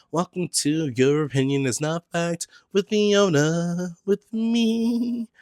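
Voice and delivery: podcast host welcome; announcer intro. Pretend. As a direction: announce the show title.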